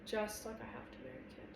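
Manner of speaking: soft, hushed admission; quiet near-whisper. A narrator's reading. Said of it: "I whisper".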